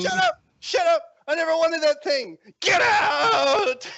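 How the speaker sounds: high, loud screech rising to a yell; furious screaming. Acted. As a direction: cut them off and scream. Girlfriend screaming at rejected suitor.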